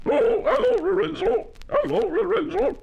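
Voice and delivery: very high, loud honking animal voice; barking sea lion shout. Acted. Sea lions shout greetings to the little llama, barking. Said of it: "the sea lions shouted out".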